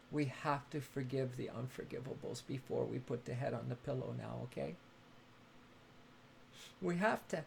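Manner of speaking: low and hushed, pressing the point gently; soft earnest urging. Acted. Host urging viewers to forgive before bed, hushed. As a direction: urge forgiveness quietly before sleep.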